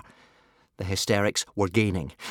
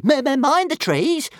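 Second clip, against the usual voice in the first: high stuttering dragon squeak; high-pitched little creature stutter; warning; 4 dB louder than usual. A narrator's reading. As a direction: stammer a helpful warning, high. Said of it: "warned Toothless helpfully".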